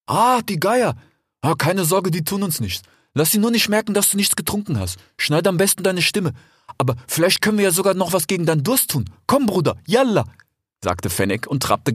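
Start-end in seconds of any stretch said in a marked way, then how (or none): 0.1-10.2 put-on a slightly higher, friendly young fox; lightly voiced fox. A narrator's reading. Desert fox talking to his brother Rix, lightly voiced. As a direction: play the eager desert fox. Fennec voice.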